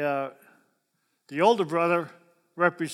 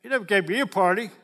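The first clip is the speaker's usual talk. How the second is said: put-on louder, fast, whining like a five-year-old; whiny child; 4 semitones higher, 6 dB louder than usual. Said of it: "He said".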